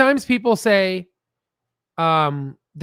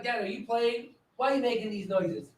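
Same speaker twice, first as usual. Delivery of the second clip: dog's voice; 3 semitones higher, 9 dB quieter than usual. Retold or imitated. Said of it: "He's like".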